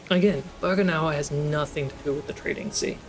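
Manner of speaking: normal talking voice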